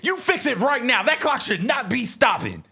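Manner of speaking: high, loud, fast mock outrage; furious yell. Wife yelling at husband over the clock, loud mock outrage. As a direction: shriek about the stopped clock. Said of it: "She's like".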